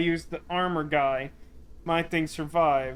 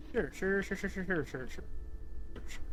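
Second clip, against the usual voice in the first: fading to a whisper; dismissive; 3 semitones higher, 8 dB quieter than usual. Real, not acted. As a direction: sure sure, trailing off to a breath. Dismissive repeated agreement fading out.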